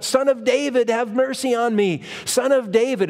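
high, pleading call for mercy to Jesus; crying out. Acted. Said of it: "And so he cried out".

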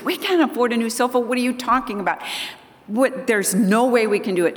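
high, exasperated brush-off; dismissive son. Retold or imitated. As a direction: shoot the idea down in disbelief. Son brushing off wife's sofa request.

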